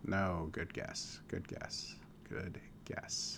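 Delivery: soft, slow, low murmur; drawn-out, near-whispered reply; hushed consolation. Real, not acted. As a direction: murmur it slowly under your breath. Host hushed, muttering consolation.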